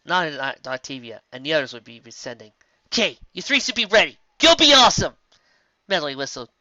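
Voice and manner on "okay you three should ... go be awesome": loud, high, cheering them on; rousing cheer. Acted. Lyra sends the three off with a big loud cheer. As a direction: pump the team up, getting louder to the end.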